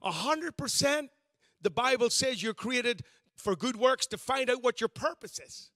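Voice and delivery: ordinary talk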